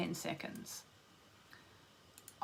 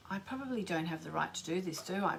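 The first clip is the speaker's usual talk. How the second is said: softer, quicker second thought; quiet realisation; 4 semitones lower, 7 dB quieter than usual. Real, not acted. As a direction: murmur it to yourself, unsure. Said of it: "oh, I've just thought".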